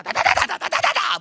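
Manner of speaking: high, loud machine-gun da-da-da; rapid-fire chatter. Retold or imitated. Mimics rapid complaining chatter.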